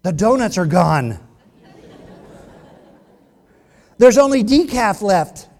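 loud, aggrieved complaint; mock outrage. Preacher mimics spoiled churchgoer at the coffee table, mock outrage. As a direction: blow up over a trivial loss.

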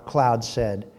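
usual speaking voice

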